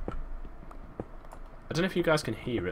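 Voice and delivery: ordinary talk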